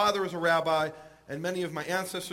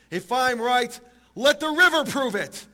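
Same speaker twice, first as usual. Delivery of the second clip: higher, raised-voice challenge, stretched at the end; shouting out; 6 semitones higher, 6 dB louder, slower than usual. Retold or imitated. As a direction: proclaim it dramatically to the heavens. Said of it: "Rabbi Eliezer shouts out".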